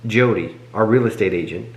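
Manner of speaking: ordinary talk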